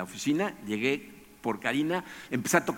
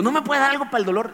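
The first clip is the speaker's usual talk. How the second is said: high, loud begging; desperate plea; 13 semitones higher, 6 dB louder than usual. Retold or imitated. Patient begs the clinic worker for painkillers, high desperate plea. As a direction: plead for relief, voice cracking upward.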